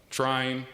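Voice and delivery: "trying" set apart by pauses for emphasis; stress on TRYING. Real, not acted. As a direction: lean on the word "trying", letting it stand alone. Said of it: "I emphasize the word trying".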